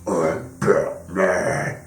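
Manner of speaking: slow, gravelly growl of a movie Batman; mock the cliché Batman rasp, dragging it out; gravelly Batman impression. Mocking how fans imitate Batman, slow gravelly Batman growl. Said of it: "whenever anyone was making a Batman impression".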